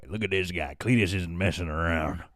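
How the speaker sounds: a hint of a gruff cowboy, low; lightly voiced Cletus. Streamer previewing the tough shooter voice, lightly gruff. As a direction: slip lightly into a rough frontier character. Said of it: "I think Cletus talks like this".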